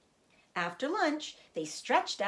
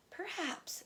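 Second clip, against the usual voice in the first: soft, tired sigh of a word; a hint of exhaustion; weary; 10 semitones higher, 8 dB quieter than usual. A narrator's reading. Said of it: "she said wearily".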